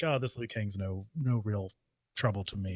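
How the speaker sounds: Goro quote. Retold or imitated. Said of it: "like, saying".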